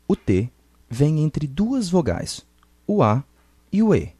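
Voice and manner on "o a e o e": ordinary talk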